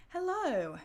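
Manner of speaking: ordinary talk, nothing marked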